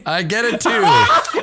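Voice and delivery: high, slow, laughing despair. Real, not acted. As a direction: laugh out the bad roll. Laughing at bad roll.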